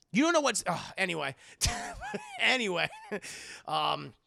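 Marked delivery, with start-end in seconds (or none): 0.1-0.6 exasperated shout. Retold or imitated. Scolding the character. 1.4-2.3 burst of laughter. Real, not acted. Laughing at own rant.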